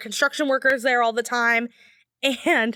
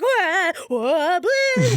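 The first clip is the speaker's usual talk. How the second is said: bewildered; 5 semitones higher, much slower than usual. Retold or imitated. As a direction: caught off guard, repeating in disbelief. Reacting to creepy man.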